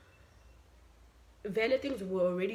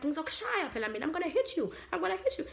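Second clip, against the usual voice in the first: shrill-free mock threat, pitched up; threatening landlady's daughter; 9 semitones higher, faster than usual. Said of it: "She was like".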